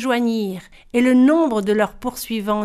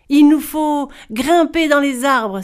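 urgent exclamation, raised in pitch and volume; exclaiming; 4 semitones higher, 6 dB louder than usual. A narrator's reading. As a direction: exclaim it, high and loud. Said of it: "s'exclama Ogri Boy". (exclamation vive et sonore)

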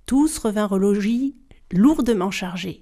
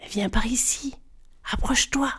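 hushed whispered call from the fountain; 5 dB quieter than usual. A narrator's reading. The first fountain whispering to the girl, hushed. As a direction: breathe it like a tempting spring.

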